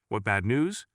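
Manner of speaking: plain reading voice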